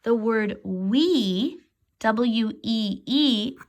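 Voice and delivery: slow, careful "wee" and "W E E"; slow spelled-out wee; slow teaching spell-out. Shown as a demonstration. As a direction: spell it letter by letter for kids. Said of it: "teacher spells word".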